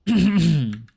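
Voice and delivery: loud throat clear. Real, not acted.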